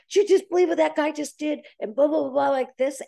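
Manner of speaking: mock agitated complainer; worked-up outrage. Someone venting to you about that guy, worked up.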